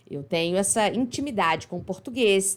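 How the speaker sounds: ordinary talk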